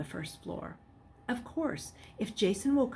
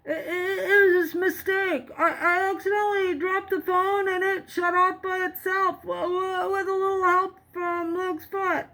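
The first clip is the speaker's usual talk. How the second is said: much higher, stammering fake excuse; nervous stammer; 11 semitones higher, 4 dB louder than usual. A narrator's reading. Boy posing as Jason, stammering a nervous excuse. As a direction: stumble through the lie.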